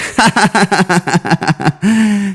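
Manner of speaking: a high, put-on giggle; mocking giggle. McDonald's workers laughing at the order, giggling. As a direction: giggle like the staff behind the counter. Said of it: "they're just giggling".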